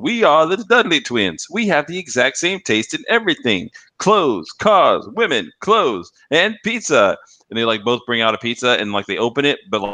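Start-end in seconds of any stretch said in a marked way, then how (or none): 0.0-7.2 higher, slow cheesy commercial twins chanting; hammed-up ad twins. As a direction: ham it up like TV twins. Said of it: "they're, like, saying".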